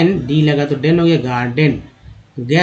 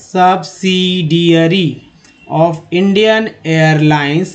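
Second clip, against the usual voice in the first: slow reading aloud; much slower than usual. Shown as a demonstration. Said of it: "teacher reads sentence slowly".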